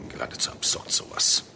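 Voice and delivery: whispered under the breath, contemptuous; muttered disgust. Acted. Professor muttering to his colleague about the absurd findings, whispered scorn. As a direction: mutter it to yourself in disbelief. Kingsley fuming on drive home.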